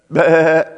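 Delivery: loud, held sheep's "baa"; imitated sheep bleat. Preacher mimicking the sheep behind Saul, loud bleating. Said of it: "you can just hear the".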